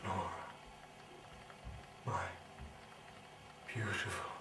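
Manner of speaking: ordinary talk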